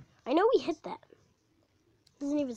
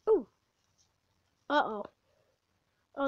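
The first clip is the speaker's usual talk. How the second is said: ordinary talk, nothing marked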